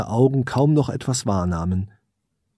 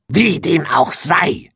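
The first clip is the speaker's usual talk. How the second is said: high, slow witch's snarl; venomous old witch voice; hissing witch; 5 semitones higher, slower than usual. A narrator's reading. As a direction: spit it out like an angry crone. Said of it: "fauchte die Hexe".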